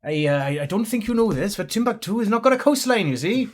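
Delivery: lightly voiced Welsh sailor, a touch high; Welsh accent. Welsh seaman correcting a passenger about Timbuktu, mild Welsh accent. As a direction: tease gently in a Welsh lilt. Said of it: "You're a funny one".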